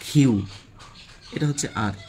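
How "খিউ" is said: emphatic "কিউ", k with a puff of air; correct letter pronunciation. Shown as a demonstration. Teacher repeating the correct Q, careful pronunciation. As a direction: hit "কিউ" loud with aspirated k. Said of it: "এটা সঠিক উচ্চারণ কি?".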